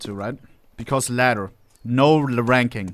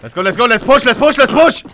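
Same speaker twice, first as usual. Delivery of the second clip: shouting; 10 semitones higher, 8 dB louder than usual. Real, not acted. Calling teammates to push.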